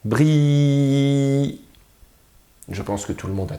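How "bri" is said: "Bri" louder, with the i held long; drawn-out ending. Shown as a demonstration. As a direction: stretch the last sound of "Bri". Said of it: "à la fin de la syllabe?".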